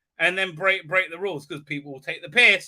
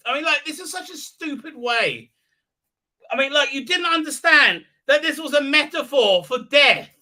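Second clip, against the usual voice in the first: higher, emphatic sputtering annoyance; exasperated rant; 7 semitones higher, 4 dB louder than usual. Real, not acted. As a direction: rant in disbelief at the idiocy. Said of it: "really annoys me".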